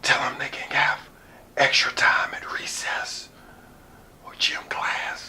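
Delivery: unvoiced whisper feeding lines. Introducer whispering lines to a frozen speaker, whispered. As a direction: hiss the lines low and secret like a prompter. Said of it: "He was like".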